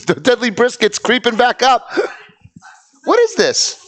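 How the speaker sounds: high, loud startled outburst; surprised. Real, not acted. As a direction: caught off guard and shouting. Startled by prank reveal.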